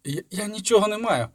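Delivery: high, stammering yell. A narrator's reading. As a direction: cry out in fear. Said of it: "щосили зарепетував Яре".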